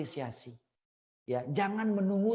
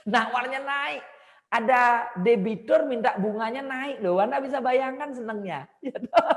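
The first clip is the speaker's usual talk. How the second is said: higher and louder, delighted; excited; 8 semitones higher, 8 dB louder than usual. Real, not acted. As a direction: tell it like it's the best news ever. (bersemangat sekali, suara tinggi dan keras)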